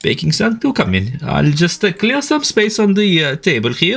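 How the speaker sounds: high, bright eager host; a welcoming high character voice; host NPC voice. Acted.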